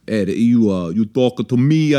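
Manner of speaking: slow attempted Russian accent sliding into Italian; botched Russian, sounding Italian. Shown as a demonstration. Host asked to do Russian, accent turning Italian. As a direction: try Russian but land on Italian. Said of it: "no, that's Italian".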